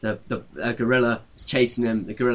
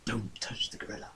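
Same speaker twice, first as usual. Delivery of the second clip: whispered echo; 17 dB quieter than usual. A narrator's reading. A remembered warning echoes in the man's head as a whisper. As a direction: ghostly whisper from memory. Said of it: "like, voices, like, going, echoing".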